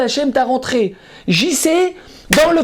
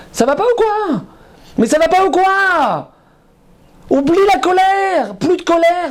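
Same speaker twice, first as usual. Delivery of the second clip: indignant scolding; 5 semitones higher than usual. Real, not acted. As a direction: shake them out of their anger. Preacher scolding congregation.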